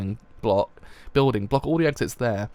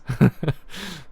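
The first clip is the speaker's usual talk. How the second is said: short laugh, a little higher; 4 semitones higher, 3 dB louder than usual. Real, not acted. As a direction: laugh at your own joke. Laughs after joking about learning.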